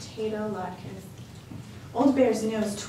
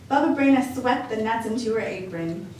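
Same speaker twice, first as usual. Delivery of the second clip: ordinary delivery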